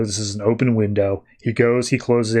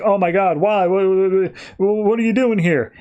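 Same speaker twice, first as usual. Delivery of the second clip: mild mock panic, higher pitch; freaking out; 8 semitones higher than usual. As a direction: play a stammering freak-out, pitched up. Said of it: "Thomas is freaking out".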